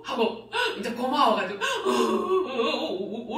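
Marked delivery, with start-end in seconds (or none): 1.6-3.4 slow, halting sobs of gratitude; sobbing. Retold or imitated. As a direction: sob out each 어 slowly, overwhelmed and thankful. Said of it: "울고 있잖아요". (감격해 느리게 흐느끼며)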